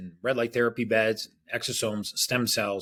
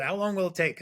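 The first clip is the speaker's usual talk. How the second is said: eager question; 10 semitones higher than usual. Retold or imitated. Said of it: "He said".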